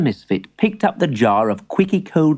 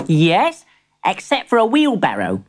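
put-on young boy voice; 6 semitones higher than usual. Boy character voice.

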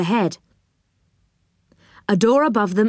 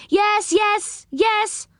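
high little answering calls; calling voices; 13 semitones higher, much slower than usual. A narrator's reading. Six voices answer Joe from below, calling out. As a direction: several voices calling back, high-pitched. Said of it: "called six voices below him".

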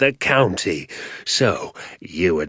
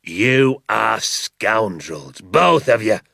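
shouting; 2 semitones higher, much slower than usual. A narrator's reading. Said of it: "shouted Mister Hazel".

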